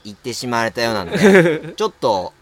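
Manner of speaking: ordinary talk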